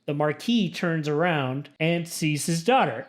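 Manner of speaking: normal talking voice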